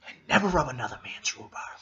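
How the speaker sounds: quick whispered villain line; Nicholson Joker quote. Retold or imitated. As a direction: toss off a silly threat in a hush. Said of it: "I just love the way it sounds".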